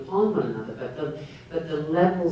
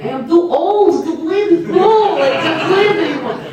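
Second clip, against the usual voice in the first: much higher and louder, laughing through a mock lament; Irina's complaint; 15 dB louder than usual. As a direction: play the aging actress wailing in half-laughing despair. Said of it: "Irina was saying".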